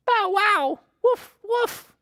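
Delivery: very high yappy puppy barking. A narrator's reading. The puppies bark at the farm animals, high yaps.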